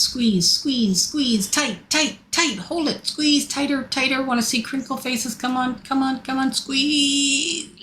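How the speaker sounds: rapid repeated urging, straining upward; urgent coaching. Real, not acted. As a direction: push them to squeeze harder. Urging group through second squeeze.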